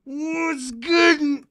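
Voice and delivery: high, drawn-out blaring shout; hammed-up loud yell. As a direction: blast it out far above talking volume. Streamer blasting viewers after volume complaints.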